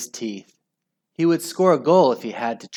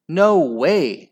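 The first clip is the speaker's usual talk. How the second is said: raised, loud contradiction; shout; 5 semitones higher, 6 dB louder than usual. A narrator's reading. Margaret contradicting Henry, shouting. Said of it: "shouted Margaret".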